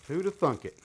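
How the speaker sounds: deadpan echo of the quip; dry repeat. Real, not acted. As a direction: mutter the joke back to yourself. Repeats the quip more quietly.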